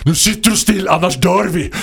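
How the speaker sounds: put-on loud, high, urgent command to a child; panicked parental order. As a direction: shout it as a desperate, scared parent. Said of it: "körde en kanske lite annorlunda pedagogisk, eh, uppfostringsmetod".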